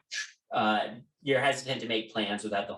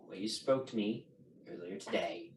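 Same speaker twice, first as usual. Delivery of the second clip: stern father relenting; 4 semitones lower than usual. Acted. Father speaks privately to daughter.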